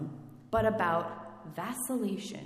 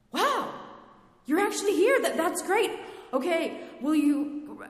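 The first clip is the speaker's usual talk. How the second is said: thrill turning into a shopping list for God; giddy demanding requests; 4 semitones higher, 9 dB louder than usual. Acted. Believer greedily demanding miracles of God, high and loud. Mock demanding prayer, rising.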